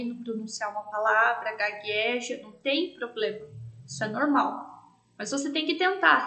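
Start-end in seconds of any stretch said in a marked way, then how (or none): none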